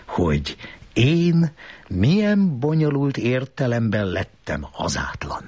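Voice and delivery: usual speaking voice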